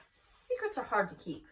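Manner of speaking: usual speaking voice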